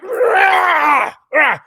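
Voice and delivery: put-on high, loud fussy baby cry; imitated baby grunt. Dad imitating his son getting finicky, high fussy shout. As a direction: mimic the baby fussing at the breast.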